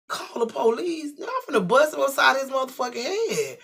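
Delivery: loud, high, incredulous outburst; furious at the idea of calling police; outraged. Retold or imitated. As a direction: scoff then threaten. Outraged at brother's advice.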